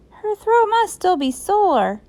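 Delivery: put-on high-pitched kids making an excuse; schoolkids. A narrator's reading. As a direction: cover up as a child would. Kids covering for fake teacher.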